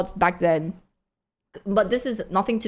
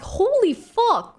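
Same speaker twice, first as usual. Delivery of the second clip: high, stretched-out exclamation; retold shock; slower than usual. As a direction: draw it out in high-pitched disbelief. Retells her shock while chased.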